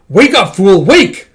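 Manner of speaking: high, loud urgent yell; shouting. Acted. A character rouses an ally turned solid, shouting. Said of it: "throat's getting dry from all the shouting".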